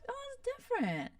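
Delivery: very high, soft little voice; relieved reaction. Retold or imitated. Vlogger acting her relief at hearing sperm babies, high relieved voice.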